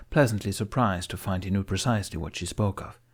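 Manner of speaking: ordinary delivery, nothing marked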